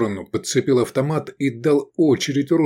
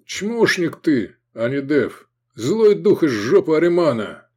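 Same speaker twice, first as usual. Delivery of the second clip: raised, scornful taunt; mocking jeer; 6 semitones higher than usual. A narrator's reading. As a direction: insult him with relish, pitched up. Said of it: "издевательски протянул блондин".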